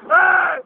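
loud open-mouthed yell; loud scream. Real, not acted. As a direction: scream as the ride drops. Scream on the ride. (grito alto)

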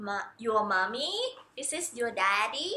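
put-on slow soft "this is your mommy" to baby; slow gentle teaching. Shown as a demonstration. Parent introduces mommy and daddy to baby, slow and soft. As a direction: coax the baby slowly and softly. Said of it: "เราสอนลูก this is".

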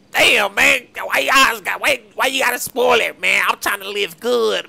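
loud, whining outburst; frustrated shout. Acted. Homeowner shouting at the robbers for spoiling his day, loud and frustrated. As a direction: yell like your good day got ruined. Complains while being robbed.

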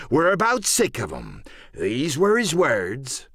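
emphatic sea-dog retelling, drawn-out words; pirate cook Silver, quoting. Acted. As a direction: relay the harsh verdict as a pirate. Silver quotes the other man.

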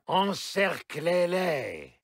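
ordinary delivery

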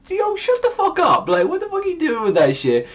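high, overblown mock yell at the judges; mock angry outburst. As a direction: squeal it out like an outraged brat. Mocking the judges, acted quote.

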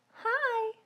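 high, soft little puppet hi; squeaky puppet saying hi; tiny puppet voice; puppet greeting. Pretend. Puppet says hi.

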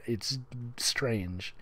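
normal talking voice

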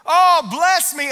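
high, loud, slow plea; pleading cry. Acted. Preacher repeating Jabez's cry for blessing. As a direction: call out for blessing. Jabez's prayer voiced again.

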